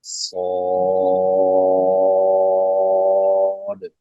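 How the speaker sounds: सौद। held for five alif lengths, very slow; long madd. Shown as a demonstration. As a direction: stretch the vowel in सौद। long and steady. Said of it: "पांच अलिफ के बराबर तीनों में लंबा किया जाएगा". (सौद। को पाँच अलिफ तक खींचकर)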